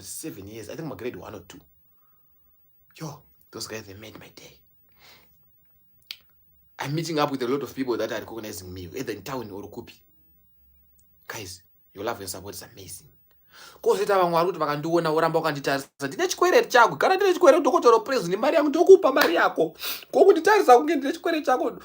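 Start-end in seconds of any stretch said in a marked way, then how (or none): 3.0-4.6 whisper. Real, not acted. Whispered aside. 16.1-21.7 mock demanding voice. Acting out people demanding money.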